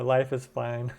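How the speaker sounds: normal talking voice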